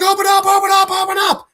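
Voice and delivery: high, urgent mock yell through a door; panicked shouting. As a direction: yell it repeatedly as if pounding to get in. Banging on the door, begging.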